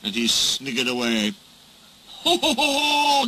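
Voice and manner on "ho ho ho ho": high-pitched hooting "ho ho" laugh; guffaw. A narrator's reading. Trucks react to Diesel's joke, high guffaw. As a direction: hoot with mock laughter on one high note. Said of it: "guffawed the trucks".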